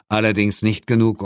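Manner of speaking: usual speaking voice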